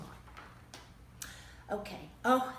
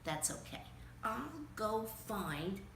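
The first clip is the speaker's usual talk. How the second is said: plain reading voice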